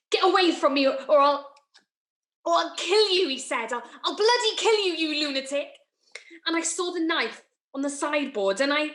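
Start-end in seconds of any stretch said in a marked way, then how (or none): 0.1-3.3 panicked angry threat. Acted. Graham threatens her, acted. 3.7-5.7 fast, high-pitched, stammered threat; panicked threat. Acted. Graham threatens her, acted.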